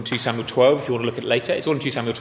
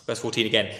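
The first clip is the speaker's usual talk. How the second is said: usual speaking voice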